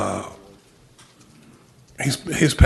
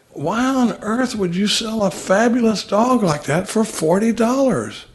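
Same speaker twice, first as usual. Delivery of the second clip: incredulous; 9 semitones higher, slower than usual. Retold or imitated. Incredulous buyer in the joke.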